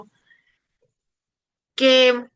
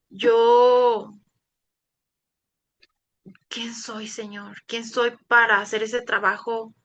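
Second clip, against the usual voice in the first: llorando; slower than usual. Retold or imitated.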